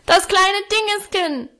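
put-on high bellowing little boy; toddler tantrum. A narrator's reading. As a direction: bawl it like a furious toddler. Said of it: "brüllte er".

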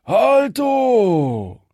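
high, loud call to halt; second bandit shout. A narrator's reading. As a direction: yell it from across the road. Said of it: "da schallte es auch von dort".

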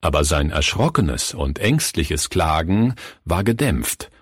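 usual speaking voice

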